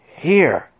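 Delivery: big man's gruff interruption; shouted. A narrator's reading. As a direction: call out to cut them off. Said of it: "shouted the big man".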